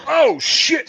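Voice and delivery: loud, high mock panic; sudden re-enacted yelp of alarm; alarmed realization. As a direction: act out jolting awake before the sergeant major. Suddenly sees the Sergeant Major.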